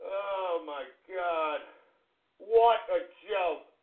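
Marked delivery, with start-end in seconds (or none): none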